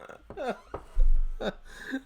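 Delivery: high breathless laugh; laughter. Real, not acted. Cracking up after imitating the drunk Irishmen, high breathless laugh. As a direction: burst out laughing at his own story.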